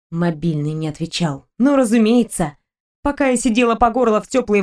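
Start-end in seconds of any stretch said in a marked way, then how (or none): 0.1-1.5 bitter quiet echo. Acted. Narrator echoes bitterly.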